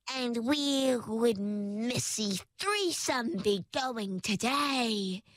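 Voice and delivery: slow, rasping creature voice; raspy wraith. Acted. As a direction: drag it out like a sly wraith. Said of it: "he inquired in a raspy voice".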